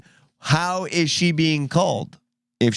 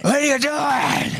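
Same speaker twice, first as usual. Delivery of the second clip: shouting; 10 semitones higher, slower than usual. Pretend. Yelling at the fighters.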